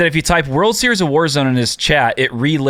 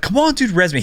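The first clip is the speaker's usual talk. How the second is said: higher, pleading whine imitating a teammate; whiny begging; 9 semitones higher than usual. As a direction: beg like a whiny kid. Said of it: "He is very whiny".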